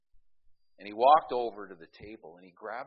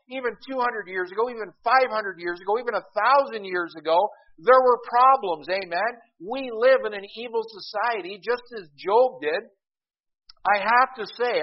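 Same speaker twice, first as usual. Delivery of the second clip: high, loud preacher's crescendo, each line climbing; preaching at full force; 9 semitones higher, 10 dB louder than usual. Acted. Preacher rousing his congregation, high and loud. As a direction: drive the congregation toward amen, rising.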